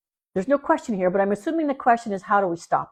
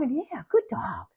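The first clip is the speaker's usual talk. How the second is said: soft whisper "good dog"; whispered, calm "good dog"; whispered praise; 2 semitones higher, 7 dB quieter, slower than usual. Shown as a demonstration. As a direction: hush your praise to keep the dog calm. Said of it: "calm praise to the dog".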